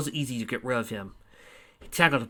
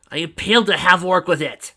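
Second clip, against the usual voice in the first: higher, louder proud shout; loud boast; 6 semitones higher, 8 dB louder than usual. Acted. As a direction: brag it loudly. Fafnir boasts loudly.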